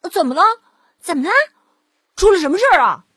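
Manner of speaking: a whole herd of pigs demanding answers; loud, high pig voices clamouring; crowding pigs. Acted.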